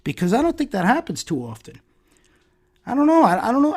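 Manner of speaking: pointedly sarcastic, pitch climbing on "I don't know"; sarcastic doubt. Real, not acted. Commentator casting doubt on reformed offenders' apologies, sarcastic. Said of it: "a sense of sarcasm in my tone".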